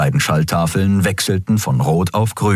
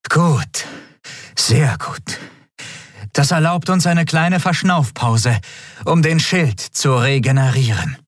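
higher, relieved captain's voice; 8 semitones higher than usual. A narrator's reading. Captain relieved that the enemy pulls back, higher voice.

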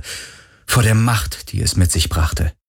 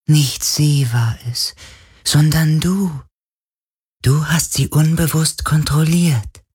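geisterhaftes Flüstern; 9 semitones higher than usual. A narrator's reading. Geist des Meeres flüstert.

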